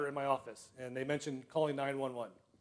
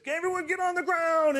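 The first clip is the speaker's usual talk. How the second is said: a high, loud hammed-up movie-robber command; 13 semitones higher, 5 dB louder than usual. Mocking how robbers in the movies order everyone down, hammed-up yell. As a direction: bark it like a Hollywood bank robber. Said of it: "In the movies, it's".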